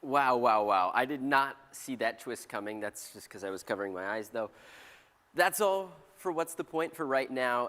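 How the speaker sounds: very low, loud comic presenter; deep presenter voice. Acted. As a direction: boom it out in a low voice.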